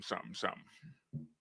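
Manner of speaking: fading into a breathy whisper. Real, not acted. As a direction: let it die into a whisper. Fades into a whisper.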